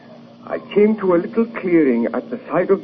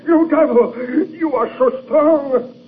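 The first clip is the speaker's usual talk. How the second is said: strained shout; 10 semitones higher than usual. Acted. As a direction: cry out as the gun is wrenched away. Struggling against the monster.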